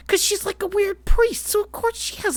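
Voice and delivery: very high, excited squeaky explanation; playful high-pitched justification. Real, not acted. Fan explaining the priestess's weird orb ship, high and excited. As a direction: jump way up in pitch as if it's obvious.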